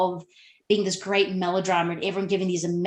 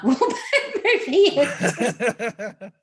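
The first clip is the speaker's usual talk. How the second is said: laughing; 4 semitones higher, 3 dB louder, much slower than usual. Real, not acted. Amused admission of hate-watching.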